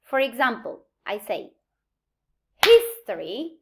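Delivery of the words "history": "history" with HIS punched loud; stress on HIS. Shown as a demonstration. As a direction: hit the first syllable of "history" hard. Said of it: "the stress is in his".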